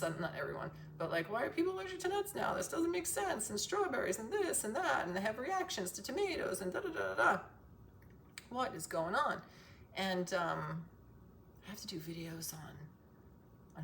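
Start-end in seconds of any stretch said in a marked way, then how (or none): 1.3-9.4 mock bewildered. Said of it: "we're like". 11.7-13.8 whispering. Real, not acted. Whispered aside.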